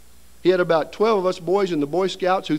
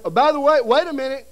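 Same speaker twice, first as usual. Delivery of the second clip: high, loud nurse calling after them; 5 semitones higher, 4 dB louder than usual. Retold or imitated. Nurse calling after the parents who left, high and loud. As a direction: nurse stopping you at the door.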